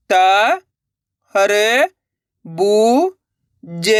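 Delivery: each syllable held long and separate, vowels swooping up, last one dropping. Shown as a demonstration. Teacher sounding out a word for learners, syllable by syllable. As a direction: spell it out sound by sound with pauses. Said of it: "एक अक्षर का उच्चारण करना है".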